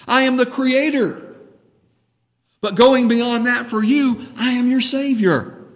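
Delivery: loud, high reassuring cry as Jesus; preacher booming Jesus's reassurance; Jesus voiced. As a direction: proclaim it as Jesus, urgently comforting.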